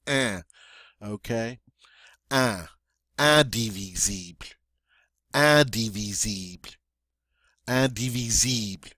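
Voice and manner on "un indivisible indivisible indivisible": "indivisible" opening on nasal "un", French "ee" vowels. Shown as a demonstration. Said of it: "this nice nasal sound at the beginning".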